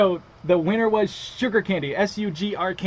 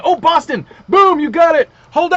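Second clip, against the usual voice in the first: shouting with real excitement, much higher and louder; excited shout; 12 semitones higher, 15 dB louder than usual. Real, not acted. Host bursts out when a viewer gets it right, excited shout. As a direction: burst out as the right answer finally comes in.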